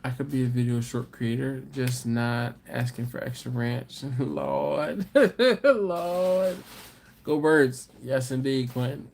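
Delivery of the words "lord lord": laughing lord. Real, not acted. Laughing exasperation at chat.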